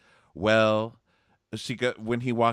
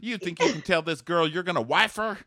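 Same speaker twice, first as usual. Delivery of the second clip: high-pitched, fast hammed-up confrontation; exaggerated outraged accusation; mock angry tirade; 11 semitones higher, 3 dB louder than usual. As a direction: rant it high and quick, imitating a furious ex. Acts out the girl's angry tirade.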